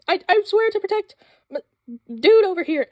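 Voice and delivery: high-pitched, earnest anime heroine vow. Retold or imitated. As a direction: swear it like a breathless magical girl. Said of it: "she's like".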